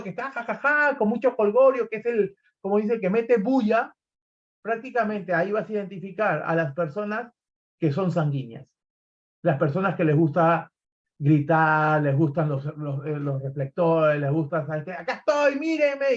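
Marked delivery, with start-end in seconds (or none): 0.3-1.0 high imitated party laughter; mock rowdy laugh. Trainer mimicking the rowdy group at a party, mock laughter. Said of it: "Ese grupo que hace alboroto". 14.9-16.1 high-pitched look-at-me call; mock attention-seeking yell. Trainer imitating sanguine people wanting the spotlight, high mock yell. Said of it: "y gritan".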